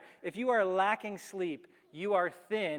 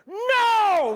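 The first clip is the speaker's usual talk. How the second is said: loud scream, much higher; 14 dB louder than usual. Retold or imitated. Cyclist screams at a car rolling toward them, panicked scream. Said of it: "We're, like, screaming at them".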